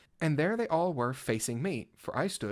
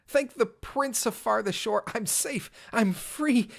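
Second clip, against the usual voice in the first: thrilled escape; 8 semitones higher than usual. Acted. Escaping through wall, thrilled.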